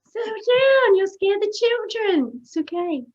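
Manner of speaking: high-pitched pretend calming-down; mock soothing. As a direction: start high and loud, ease into soothing. Mock-soothes the yeller, frightened children.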